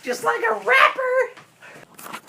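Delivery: ordinary delivery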